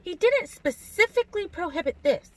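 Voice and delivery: high, musing voice; light high-voiced self-justifying; murmured to herself. A narrator's reading. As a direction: reason it out to yourself, pitched high. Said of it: "she murmured to herself".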